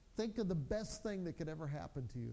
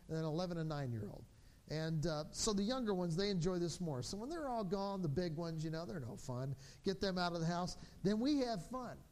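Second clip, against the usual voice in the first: normal talking voice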